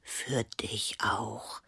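slow, low, hushed reply; quietly. A narrator's reading. Leo returns Annabella's wish, quietly. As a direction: murmur it very softly and slowly. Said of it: "sagte Leo leise".

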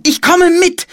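put-on very high, loud child's cry; urgent resolve. A narrator's reading. Sister decides to join her brother, urgent cry. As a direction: call it out high and determined.

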